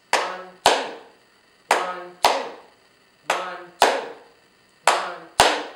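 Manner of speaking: ONE, TWO as separate even beats; two-beat count. Shown as a demonstration. Teacher showing two syllables, clapped count of ONE, TWO. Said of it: "two syllables that sounded like this".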